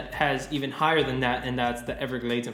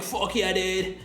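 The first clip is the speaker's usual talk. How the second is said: higher SoCal bro, pitched up; stoked Southern California dude accent, pitched up; Southern Cal accent; 6 semitones higher, slower than usual. Acted. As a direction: cheer him on like a SoCal surfer. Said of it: "Southern Cal accent".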